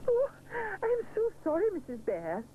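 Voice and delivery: very high, flustered apology; embarrassed by the stuck pancake; crying out. A narrator's reading. Mister Sponge apologising to Missus Bear, flustered cry. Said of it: "he cries".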